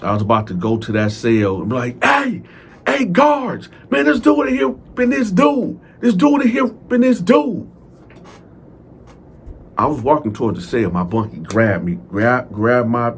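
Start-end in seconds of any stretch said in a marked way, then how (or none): 2.0-7.7 high, urgent call to the guards. Retold or imitated. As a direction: shout for the guards, pitched way up. Calling out to the guards.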